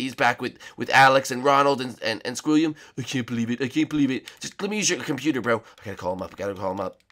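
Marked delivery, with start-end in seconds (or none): none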